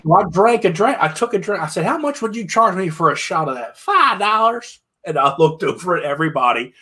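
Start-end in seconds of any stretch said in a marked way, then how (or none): 1.8-4.8 bartender. Retold or imitated. Bartender quoted, higher voice.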